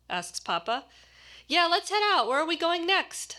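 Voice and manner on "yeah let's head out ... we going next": put-on high, eager kid voice; bursting to know what's next; lightly voiced excited kid; beaming with excitement. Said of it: "beaming with excitement".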